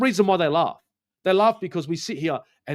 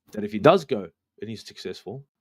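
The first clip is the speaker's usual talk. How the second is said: normal talking voice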